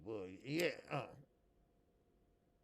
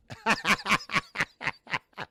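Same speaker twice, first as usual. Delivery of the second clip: hearty laugh at his own joke; loud laugh; 5 semitones higher, 7 dB louder than usual. Real, not acted. Host laughing at his own divorce joke, loud and hearty. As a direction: laugh out loud, pleased with the punchline.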